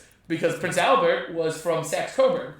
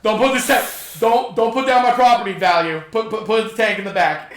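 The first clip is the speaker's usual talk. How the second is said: louder and higher, a bossy grandma giving orders; mock grandma bossing; 4 semitones higher, 6 dB louder than usual. Grandma bossing the Germans about her yard, mock loud. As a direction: boss the soldiers around like a fussy grandma. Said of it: "my grandma also would ... be like".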